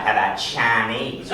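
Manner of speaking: slow, low Southern take on "Chinese"; thick Southern accent. Acted. Southerner suggesting dinner, thick Southern accent. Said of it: "a thick Southern accent say the word Chinese".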